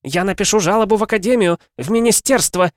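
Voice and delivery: high, loud, agitated threat; a wife threatening complaints, high and loud; nervous. A narrator's reading. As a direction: blurt it out on edge. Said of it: "нервно произнесла она".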